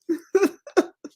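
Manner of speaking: high, sheepish giggle; embarrassed laugh. Real, not acted. Laughs off her hair talk.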